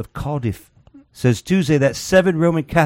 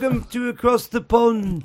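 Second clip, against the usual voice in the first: high, slow mock British accent; bad English accent; 11 semitones higher than usual. Host opening the segment in a mock English accent. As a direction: put on a hammy English accent. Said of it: "worst, uh, English accent ever".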